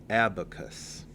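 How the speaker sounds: Abacus said as AB-uh-kus, falling at the end; "Abacus" sounded out with a strong first syllable; first-syllable stress. Shown as a demonstration. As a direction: stress the AB in "Abacus", short unstressed middle. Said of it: "The stress is on the first syllable".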